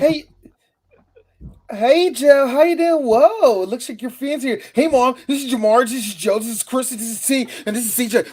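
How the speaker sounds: high, loud, excited fan greeting. Acted. A fan introducing his mom and friends to Joe, high excited voice. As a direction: gush like a starstruck fan introducing family.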